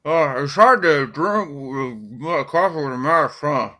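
slow, loud mumble through a thick mask; talking through heavy cloth, words smeared; muffled by mask. Acted. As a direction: smother the words until barely intelligible. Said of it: "mask is far too thick, and not a word of what he says could be understood".

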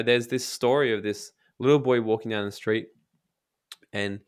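ordinary talk

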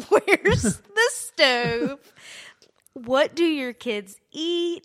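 loud, high, very drawn-out question; hammed-up clueless new nanny; mock overwhelmed questioning. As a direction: stretch each word in exaggerated bewilderment. Retold overwhelmed questions to mom.

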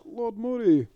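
quick Irish-accented nobleman impression; mock Irish accent. Mocking the film's Scottish noble, in a light Irish accent. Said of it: "that Scottish noble had an Irish accent there".

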